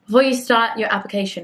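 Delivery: ordinary talk, nothing marked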